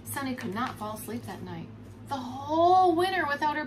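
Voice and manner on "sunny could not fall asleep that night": plain reading voice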